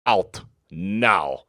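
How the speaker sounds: loud, gruff Russian order; Russian accent command. Acted.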